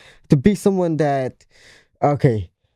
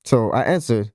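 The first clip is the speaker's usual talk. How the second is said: usual speaking voice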